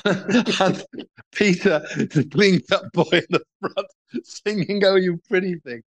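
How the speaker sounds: amused, struggling to get the words out; laughing while talking. Real, not acted. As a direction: tell it through real laughter. Laughing at his own absurd anecdote.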